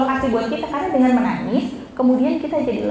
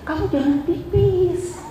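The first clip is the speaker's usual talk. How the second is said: slow, higher put-on command; mock forbidding; 4 semitones higher, slower than usual. Teacher forbids someone desperate to pee, mock forbidding. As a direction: draw out a playful, raised prohibition. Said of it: "Itu rasanya kayak kita mau pipis".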